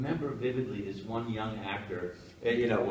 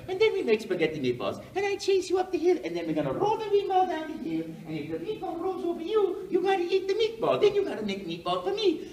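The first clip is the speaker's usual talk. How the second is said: very high, loud cartoon Italian voice; Mario; 5 dB louder than usual. Actor improvising nonstop at audition, high excited Italian cartoon voice. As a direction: babble merrily in a squeaky Italian plumber voice. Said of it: "I just kept going".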